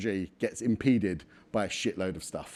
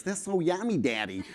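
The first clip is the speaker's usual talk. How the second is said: put-on small boy voice with a light American accent, pitched higher; light American accent of a little boy, voice raised higher; son's slight American accent; 6 semitones higher than usual. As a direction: imitate a four-year-old's American-tinged delight. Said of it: "still speaking with a slight American accent".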